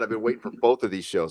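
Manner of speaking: usual speaking voice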